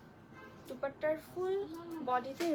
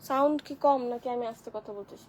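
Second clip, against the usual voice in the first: louder, checking that she can be heard; raised voice; 7 dB louder than usual. Real, not acted. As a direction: speak up to a listener who can't hear. Answers complaint that sound is low. (জোর গলায়, একটু চেঁচিয়ে)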